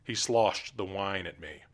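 ordinary delivery

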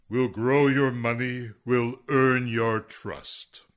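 slow, sonorous commercial voiceover. Acted. Noel quotes the firm's ad, slow voiceover tone. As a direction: read it like a slogan in a TV ad. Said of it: "matched perfectly the sonorous tones of the ad's voiceover actor".

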